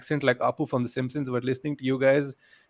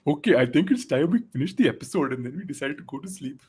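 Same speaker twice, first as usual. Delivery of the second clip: lightly put-on Indian accent like Apu; a hint of Apu's Indian accent. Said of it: "we're gonna try and sound like Apu".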